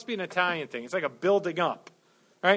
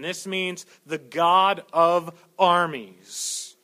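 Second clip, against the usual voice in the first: very slow, louder, weighing each word; slow weighty pronouncement; slow emphatic explanation; 5 dB louder, much slower than usual. Acted. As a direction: land it word by word.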